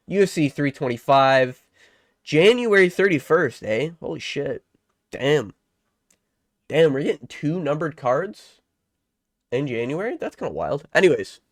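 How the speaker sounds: ordinary delivery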